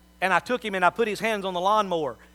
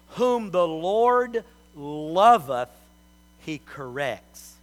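very slow, weighted Bible quote; solemn scripture reading; much slower than usual. Real, not acted. Quoting scripture slowly.

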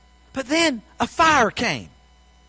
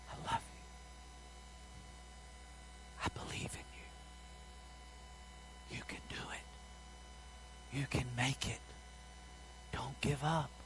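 God's whisper; 7 semitones lower, 24 dB quieter than usual. Acted. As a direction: whisper it like a still small voice within. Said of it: "hear God whisper".

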